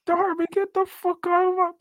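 very high comic holler; high exasperated shriek, breaking into laughter; mock screaming. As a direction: yell it hysterically from inside the house. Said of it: "that shit be screaming from the inside".

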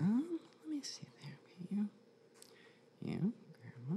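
slow murmured whisper. Real, not acted. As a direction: whisper to yourself, slowly. Medium concentrating during reading.

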